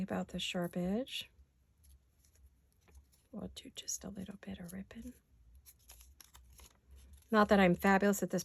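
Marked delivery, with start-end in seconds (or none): 3.3-5.1 whispering. Real, not acted. Quiet aside while ripping paper.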